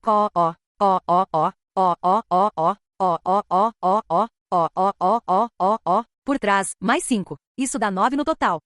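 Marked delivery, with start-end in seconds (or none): 0.1-6.0 low rumbling beast growl in repeated bursts; creature growl. A narrator's reading. One-eyed dog beasts closing in on the party, low menacing growl. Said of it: "Com aquele rosnado arrepiante".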